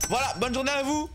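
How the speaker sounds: mock-polite shop clerk; polite cashier voice. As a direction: serve customers with a bright overly courteous cashier voice. Plays polite cashier to customers.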